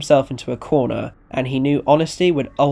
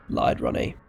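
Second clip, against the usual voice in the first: ordinary delivery, nothing marked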